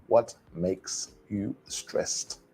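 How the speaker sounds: deliberate unlinked pronunciation demo; each word separate. Shown as a demonstration. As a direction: leave a clear gap after each word of "What makes you stressed". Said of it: "pronounce each word separately".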